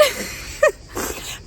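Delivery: high-pitched real giggle; laugh. Real, not acted. Vlogger amused by own retold brush-off, high laugh. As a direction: let out a short high laugh.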